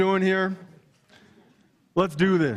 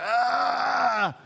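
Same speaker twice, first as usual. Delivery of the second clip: high, far-off war yell shout; mock battle yell; 6 semitones higher than usual. Preacher shows his team's huddle, mock battle yell. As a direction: let out a mock warrior's cry. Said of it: "We're like".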